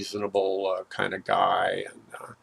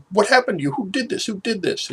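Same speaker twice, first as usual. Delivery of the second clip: high, shocked questions from a frightened mom; alarmed mother; 11 semitones higher than usual. Retold or imitated. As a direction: demand answers, startled and upset. Mom finds daughter naked, crying.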